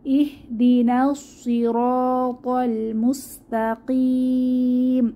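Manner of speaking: slow chanted verse; tajweed reading; Quran recitation. Shown as a demonstration. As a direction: recite slowly with heavy letters. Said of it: "তাহলে কিভাবে পড়বো".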